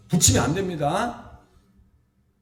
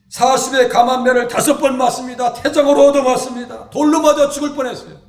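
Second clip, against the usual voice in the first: rising, louder preacher's list of sufferings; preaching loud; 3 semitones higher, 7 dB louder than usual. Real, not acted. Preacher to congregation, loud rising list of sufferings. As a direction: hammer each blow home from the pulpit.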